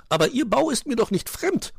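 high, loud magpie retort; countering. A narrator's reading. Magpie counters the fox about his den, retorting. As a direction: fire it back, pitched right up. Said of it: "konterte sie".

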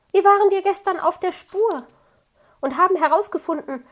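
put-on an excited young animal calls out to the fox cub, loud; called out. A narrator's reading. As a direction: call it out eagerly, quickly at first. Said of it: "rief Borstel".

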